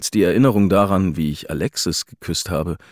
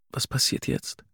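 hushed, uncertain question; whispered, unsure; 6 semitones higher, 7 dB quieter than usual. A narrator's reading. Unsure wife asks softly.

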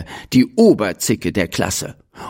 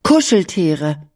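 put-on higher, shy girl blurting the word Kuscheltiere; blurted out; 7 semitones higher than usual. A narrator's reading. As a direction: let Kuscheltiere slip out eagerly. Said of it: "platzte Finja heraus".

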